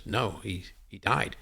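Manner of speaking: soft, halting delivery; hushed retold bad news, faltering; breaking bad news. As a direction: break a death gently, voice dropped. Retelling friend breaking death news.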